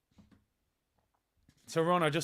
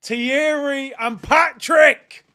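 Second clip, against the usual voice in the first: excited shout; 7 semitones higher, 11 dB louder, much slower than usual. Real, not acted. Fan hails returning legends.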